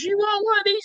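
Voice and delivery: very high, sweet offering voice; innocent wife. Retold or imitated. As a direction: offer the snack all innocent. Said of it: "she goes".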